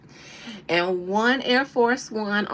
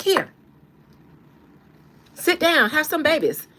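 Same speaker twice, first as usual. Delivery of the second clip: sassy dismissal; 5 semitones higher, 7 dB louder than usual. Retold or imitated. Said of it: "She was like".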